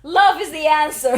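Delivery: loud, hammed-up moral of the story; mock proclamation. Mocking the film's message to co-host, loud mock proclamation. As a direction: proclaim it big, about to laugh.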